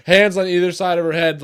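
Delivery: usual speaking voice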